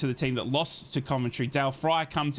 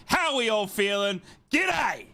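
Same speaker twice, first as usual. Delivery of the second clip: hyped shouted greeting; 6 semitones higher, slower than usual. Real, not acted. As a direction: build to a high, shouted greeting. Hyped greeting to stream audience.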